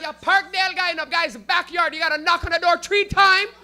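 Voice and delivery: high, full-volume street holler; yelling neighborhood locals. Retold or imitated. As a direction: shout directions across the whole block. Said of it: "Everybody's gonna yell out".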